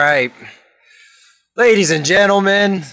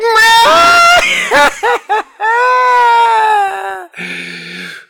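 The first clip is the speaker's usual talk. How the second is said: long, high, hammy spooky cackle; evil cackle; 3 dB louder than usual. Acted. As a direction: cackle like a Halloween ghoul. Host's laugh after the pun.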